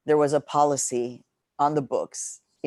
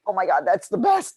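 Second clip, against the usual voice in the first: bright, louder burst of delight; excited exclamation; 7 semitones higher, 5 dB louder than usual. Retold or imitated. Retelling her delight at the journalist's remark, excited exclamation. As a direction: gush like you just heard great news. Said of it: "And I was like".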